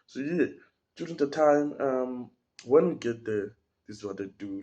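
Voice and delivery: usual speaking voice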